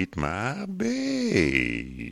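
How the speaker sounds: very drawn-out, clumsy word stretching; slow-running Speak and Spell. Acted. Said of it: "the speak and spell that goes".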